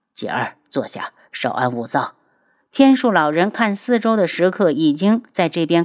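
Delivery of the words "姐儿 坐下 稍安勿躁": put-on much lower, softer old master; calm elder soothing, low and quiet; old man voice. A narrator's reading. Old master calms disciple. (低沉老者声)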